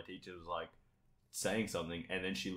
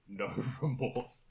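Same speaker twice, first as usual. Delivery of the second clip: retold scream; 4 semitones higher, much slower than usual. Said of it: "he just screamed".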